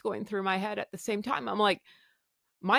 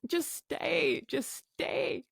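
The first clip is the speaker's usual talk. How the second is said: softer, begging please; soft pleading; 5 semitones higher, 5 dB quieter, much slower than usual. Retold or imitated. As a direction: plead quietly with her to stay. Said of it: "my husband is pleading with me".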